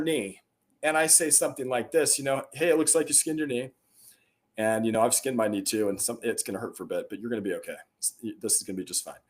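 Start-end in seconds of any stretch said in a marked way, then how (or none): 2.5-9.2 calm. Acted. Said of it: "hear that calmness in my voice".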